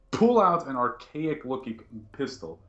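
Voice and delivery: normal talking voice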